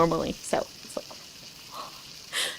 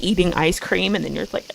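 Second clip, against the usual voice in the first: ordinary talk, nothing marked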